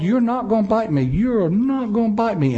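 high-pitched holler without much volume; retold hollering. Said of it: "I start hollering to that dog".

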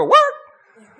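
high, loud startled shriek of work; Maynard's yelp. Retold or imitated. Preacher imitating Maynard to the congregation, loud high yelp. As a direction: shriek the word work as if burned. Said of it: "we respond like Maynard ... and we all go".

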